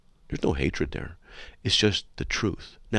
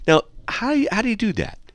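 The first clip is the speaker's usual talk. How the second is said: incredulous; 13 semitones higher than usual. Real, not acted. Incredulous at caller's logic.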